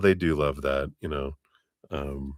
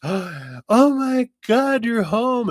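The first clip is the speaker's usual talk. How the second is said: much higher, slow, gushing squeal; excited dog; 4 dB louder, much slower than usual. Retold or imitated. Dog greeting its owner home, excited high squeal. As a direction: gush like an overjoyed dog at the door. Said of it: "a dog who's like".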